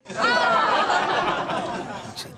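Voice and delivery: a surprised laugh breaking through a high squeal. Real, not acted. Host laughing at his co-host's joke, high laughing squeal. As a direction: let the laugh burst out on it.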